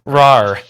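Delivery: loud, high playful rar; huge fiery dragon roar. Shown as a demonstration. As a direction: bellow a cartoon monster roar. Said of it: "So you guys hear this just roar".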